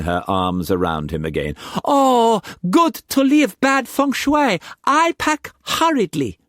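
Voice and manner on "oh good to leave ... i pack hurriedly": high, loud delighted squeal. A narrator's reading. Mei Mei thrilled to leave the house, high and loud. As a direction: burst with joy, high and loud.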